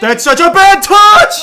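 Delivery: loud, high, slow angry yell; frustrated yell. Real, not acted. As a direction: yell it out in disbelief. Streamer yells at bad touch.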